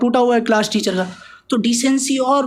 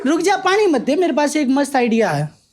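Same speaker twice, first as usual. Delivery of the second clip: high rough Haryanvi mum, scolding; bossy desi mother, pitched up; 6 semitones higher than usual. Acted. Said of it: "मेरी माँ, देसी हरियाणा की जाट".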